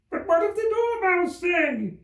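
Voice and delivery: very high, slow questioning voice; a curious juror calls out; eager juror. Acted.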